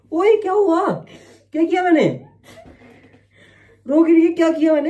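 high, loud, insistent demand; urgent questioning. Real, not acted. Man asking his crying partner what he did, urgent. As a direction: press for an answer, pitched right up.

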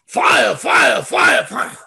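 half-mocking shout that fades out; mock fire shout. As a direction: ham up a revival preacher crying fire. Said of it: "there's videos".

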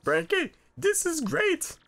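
very high squeaky congratulations; happy cartoon sidekick. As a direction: cheer the couple in a silly falsetto. Same squeaky character congratulates Frankie.

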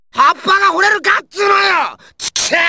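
high-pitched enraged shout; angry outburst. Acted. Ranting over a nonsensical idiom, furious shout. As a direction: explode in exasperated fury.

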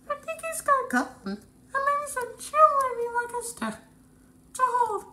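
shrill-sounding cartoon crab voice squeaked up high; squeaky bandit.

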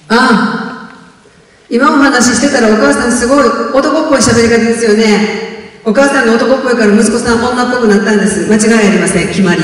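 a touch of a brash radio advisor; radio counselor. Retold or imitated. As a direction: hand down the verdict like a smug know-it-all advisor. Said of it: "相談員は".

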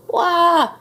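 high, loud mock baby wail; baby ghost's yell. A narrator's reading. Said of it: "yelled baby ghost".

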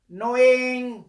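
KNOWING called out high and loud; stress on knowing. Shown as a demonstration. Teacher calls Knowing to students, high and loud. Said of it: "teacher stresses the word".

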